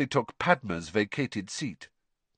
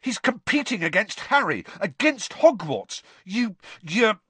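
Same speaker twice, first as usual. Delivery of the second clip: high, indignant accusation; heated, lost for words; spitting anger; 11 semitones higher than usual. A narrator's reading. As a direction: splutter with outrage. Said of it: "spat Ron".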